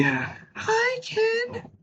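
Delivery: very high squeaky doll voice; Barbie squeak. Retold or imitated. Answering Ken as Barbie, very high squeaky doll. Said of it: "how Ken opens up".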